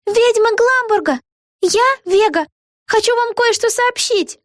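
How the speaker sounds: high, slow proclamation to a crowd; mock shout, drawn out and high-pitched; calling out. A narrator's reading. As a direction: call it out in a high voice. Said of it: "закричала".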